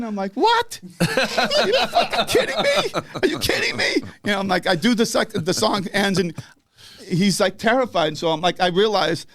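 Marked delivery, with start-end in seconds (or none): 0.3-3.9 very high mock squeal through laughter; hammed-up, laughing disbelief; incredulous outrage. Singer reacts to demand to repeat his song, incredulous.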